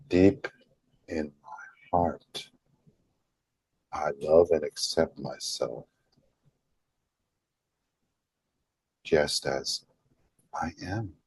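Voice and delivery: unhurried affirmation, long pauses between phrases; slow and deliberate. Shown as a demonstration. Modeling an affirmation for followers to repeat, slow and deliberate. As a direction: say it deeply and deliberately, softening after the first word. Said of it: "the slowness, the deliberateness in how you say that".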